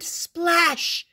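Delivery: high, loud exclaimed splash; big splash. A narrator's reading. Narrator as Froggy hits the pond, big splash. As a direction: burst out with the impact.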